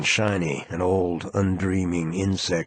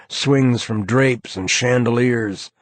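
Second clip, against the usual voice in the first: ordinary delivery, nothing marked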